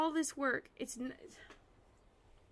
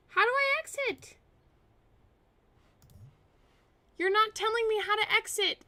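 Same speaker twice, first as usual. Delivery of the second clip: higher, louder angry outburst; raging; 5 semitones higher, 8 dB louder than usual. Real, not acted. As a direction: yell at the stuck program. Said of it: "I was raging a little bit there".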